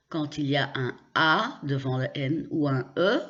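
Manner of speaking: normal talking voice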